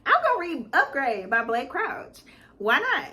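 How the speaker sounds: normal talking voice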